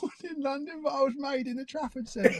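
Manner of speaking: very high, squeaky mock London accent; mock accent impression. Host topping co-host's born-in-London line, mock accent impression. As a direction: parody your mate's accent in a squeal.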